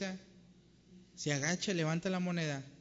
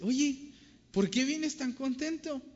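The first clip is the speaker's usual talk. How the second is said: mother's voice; 11 semitones higher, 5 dB louder, slower than usual. Acted.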